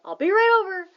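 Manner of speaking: high, loud excited yell. A narrator's reading. Doctor yelling down the phone to Nate. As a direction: shout it with a whoop of joy. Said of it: "Doctor Zeimer yelled".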